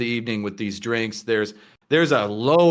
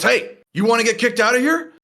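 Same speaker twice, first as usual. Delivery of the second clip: higher, louder warning from the barman; barman's threat; 10 semitones higher, 4 dB louder than usual. Acted. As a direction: threaten him, pitched up. Barman threatens to throw him out.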